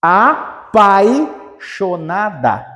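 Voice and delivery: apaixonada split very slowly, pai held as one syllable; slow teacher's syllable count on apaixonada. Shown as a demonstration. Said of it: "Vejam que o pai ficou junto".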